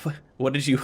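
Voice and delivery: ordinary talk, nothing marked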